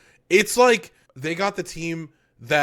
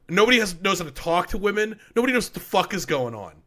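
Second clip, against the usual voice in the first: ordinary talk, nothing marked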